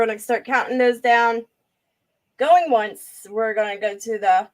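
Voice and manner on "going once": loud, bright auctioneer call to the chat. Real, not acted. Streamer calling out the first bid to viewers, loud auction call.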